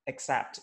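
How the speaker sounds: teacher models the second word accept as ik-sept; except said correctly. Shown as a demonstration. Teacher showing students the right except. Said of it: "the way to pronounce this correctly is".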